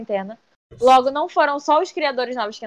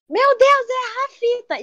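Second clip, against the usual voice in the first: high-pitched excited fan cry; retold fan scream, pitched way up; imitating fans yelling; 11 semitones higher than usual. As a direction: mimic a crowd calling out from afar. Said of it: "o pessoal veio gritando lá de cima".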